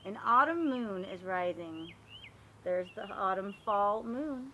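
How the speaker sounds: ordinary delivery, nothing marked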